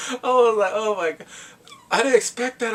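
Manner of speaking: high, loud, overcome exclamation; real excited surprise; astonished reaction. Real, not acted. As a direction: blurt it out in amazed disbelief. Amazed reaction after laughing.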